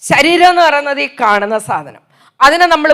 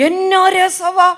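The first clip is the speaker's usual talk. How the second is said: ironic delight; 5 semitones higher, much slower than usual. Real, not acted. Slow ironic exclamation.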